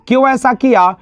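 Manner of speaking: normal talking voice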